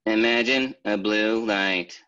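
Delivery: slow, loud, drawn-out meditation voice; hammed-up meditation CD voice; mock guided meditation. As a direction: parody a cheesy meditation guide. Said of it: "and you go".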